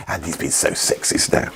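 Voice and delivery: low whispered aside; whispering. Pretend. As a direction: whisper it to the audience. Whispered aside about himself.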